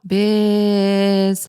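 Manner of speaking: long, even vocal buzz; imitated phone buzz. Telling viewers that Ryle is calling, imitated phone buzz. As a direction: hold a buzz like a ringing phone.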